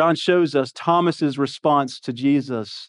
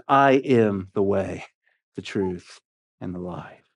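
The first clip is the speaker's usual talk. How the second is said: solemn declaration; 9 semitones lower than usual. Acted. Jesus' famous words, solemn.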